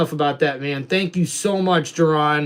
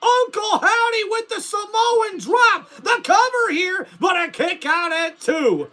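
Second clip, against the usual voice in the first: higher, excited wrestling commentator call; play-by-play commentary; 6 semitones higher than usual. Acted. Streamer calling the wrestling game for chat, excited play-by-play. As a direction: call the match like a TV announcer.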